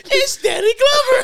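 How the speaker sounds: very high, slow, laughing shout; shrieking laughing reveal. Retold or imitated. Friend acts out the unmasking, shrieking with laughter. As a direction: shriek the name while cracking up. Mimicking a shocked movie character.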